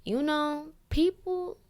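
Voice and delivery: high put-on professor voice; mock professor, pausing between words; professor impression. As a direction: imitate the professor, pitched well up and halting. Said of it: "that's how he sound".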